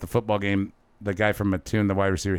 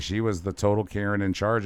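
ordinary talk

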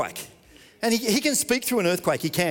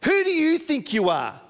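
high, loud sneering challenge; taunting shout; 8 semitones higher, 3 dB louder than usual. Acted. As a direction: throw it at them like an accuser. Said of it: "The enemy shouts lies at us".

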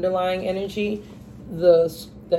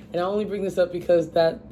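usual speaking voice

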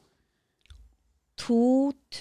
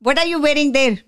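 loud teacher voice; 6 semitones higher, 8 dB louder than usual. Retold or imitated. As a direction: boom it like a domineering guru. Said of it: "Og han bare". (brautende, høy lærerstemme)

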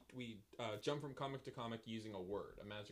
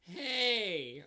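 high, loud whiny hey; 8 semitones higher, 7 dB louder than usual. A narrator's reading. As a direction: whine it like a pest. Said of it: "a whiny voice says in your ear".

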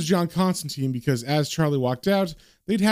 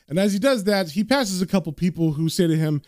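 ordinary delivery

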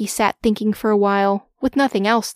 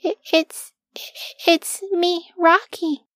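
high, stuttering, timid introduction; small nervous character voice; shaky; 8 semitones higher, slower than usual. Acted. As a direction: falter nervously on the first words. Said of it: "His voice was so shaky".

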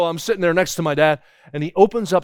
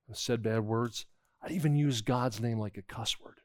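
slow and much softer, owning up; a boy confessing quietly to his father; sheepish confession; 4 semitones lower, 8 dB quieter than usual. Retold or imitated. Boy confessing his sins to dad.

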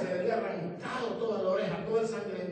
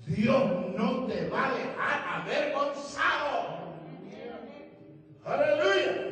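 slow, raised, emphatic proclamation; full-force preaching; 6 semitones higher, 4 dB louder, much slower than usual. Acted. Preacher assuring those God has sent, full-force preaching. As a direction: drive it home, rising to a loud hallelujah.

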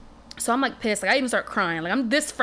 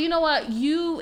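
excited; 7 semitones higher than usual. Retold or imitated. Mom retells excited reply.

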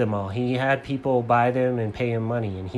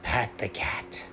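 hushed, slow whispered reveal; dramatic whisper; 3 semitones lower, 9 dB quieter, slower than usual. A narrator's reading. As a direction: whisper the name like a secret.

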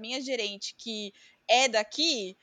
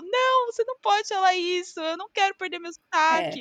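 higher, insistent mock protest from the coworker; imitating upset coworker; 6 semitones higher, 5 dB louder than usual. Australian coworker objecting to being told she lost her accent, mock upset. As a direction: play the friend refusing to lose her accent. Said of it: "e ela ficou".

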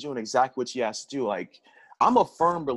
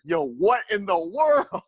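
incredulous; 6 semitones higher, 3 dB louder, slower than usual. Retold or imitated. Retold reaction, disbelief.

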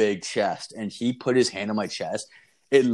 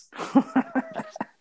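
high, airy burst of laughter; cracking up, no voice; high breathless laugh. Real, not acted. Laughing at the friends nearly getting shot.

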